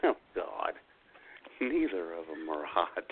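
usual speaking voice

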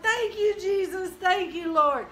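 high-pitched reenacted cry of praise; excited praise shouting. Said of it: "I started shouting".

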